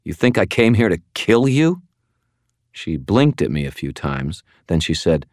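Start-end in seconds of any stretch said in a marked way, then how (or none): 0.0-1.8 disgusted. Acted. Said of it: "as disgusted as I felt".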